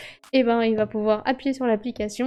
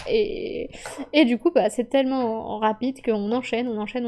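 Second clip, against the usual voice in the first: normal talking voice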